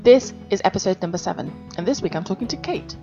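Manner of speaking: bright host intro read; upbeat show opening; podcast intro. Acted. Podcast intro read over music.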